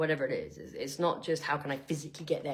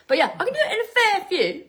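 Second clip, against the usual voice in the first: laughing brag, higher, louder and fast; 13 semitones higher, 10 dB louder, faster than usual. Real, not acted. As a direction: gush it out through a laugh. Laughing boast.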